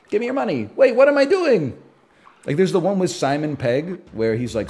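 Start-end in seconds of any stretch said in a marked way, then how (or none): 0.1-1.8 high-pitched robber jolting into confusion; mock hypnotized robber. Duped person robbing a bank, then higher mock alarm. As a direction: start demanding, then break into higher, louder bewilderment. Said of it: "And everybody's like".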